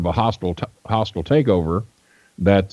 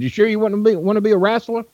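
much higher put-on old promoter voice; imitating Nick Gulas; 13 semitones higher, faster than usual. Old promoter greeting a young fan, high imitated voice. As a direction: play the old wrestling boss greeting a stranger, pitched way up. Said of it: "That, that's kinda how he talked".

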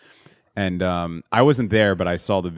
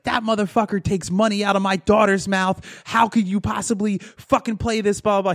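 mock freakout; 11 semitones higher than usual. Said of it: "she starts freaking out".

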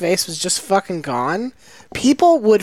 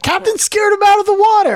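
high-pitched, raised-voice mock crew cry; panicked crewman callout; 12 semitones higher, 4 dB louder than usual. A Russian sailor topside reporting on the captain, mock alarmed shout. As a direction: call it out like an alarmed sailor on deck. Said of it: "top side who are like".

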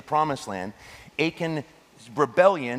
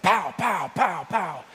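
higher, playful pow pow sound effects; mock gun sounds; 7 semitones higher, slower than usual. Preacher mimicking a pacifist's violent daydream, playful pow sounds. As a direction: fire off imaginary shots with a grin. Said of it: "You're just like".

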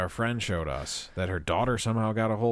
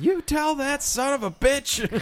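high, slow put-on Texan drawl; mock Texan businessman accent, over the top; George Costanza Texan; slower than usual. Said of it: "he starts talking like".

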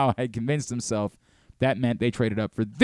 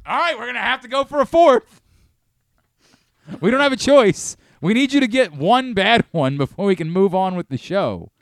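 higher and louder, hyped up; rally for one more try; excited; 7 semitones higher, 7 dB louder than usual. Real, not acted. Host hyped for a fourth bean.

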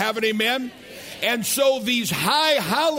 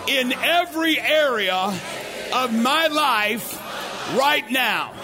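call and response declaration; 4 semitones higher than usual. Shown as a demonstration. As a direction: lead the crowd in a shouted confession. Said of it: "Say this out loud".

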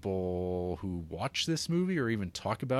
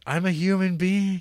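high, louder cry of a desperate woman; 10 semitones higher, 6 dB louder than usual. Retold or imitated. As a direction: yell it out, pitched well up. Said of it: "she's like".

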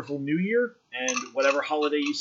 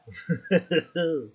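cartoonish cackle; silly over-the-top laugh; 4 semitones higher than usual. Real, not acted. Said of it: "that was a cartoonish laugh".

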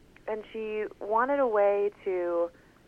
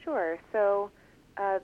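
usual speaking voice

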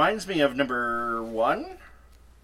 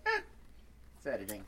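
shrugging; 4 semitones lower, 10 dB quieter, faster than usual. Retold or imitated. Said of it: "And I was like".

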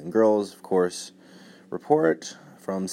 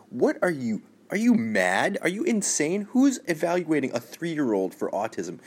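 incredulous outrage; 7 semitones higher than usual. Real, not acted. Outraged at the article.

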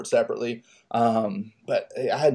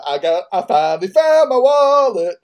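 overjoyed; 8 semitones higher, 6 dB louder than usual. Retold or imitated. Skipping happily down street.

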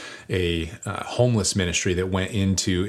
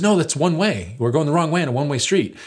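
alarmed warning; 7 semitones higher than usual. Retold or imitated. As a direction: stop the driver in a hurry. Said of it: "the guy in the passenger seat says".

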